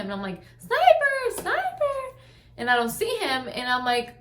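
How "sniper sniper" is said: high, loud call across the yard; calling the dog. Retold or imitated. Searching the yard for her missing husky, calling loud and high. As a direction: yell the dog's name out into the dark.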